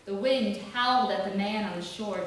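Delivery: ordinary delivery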